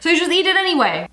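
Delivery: ordinary talk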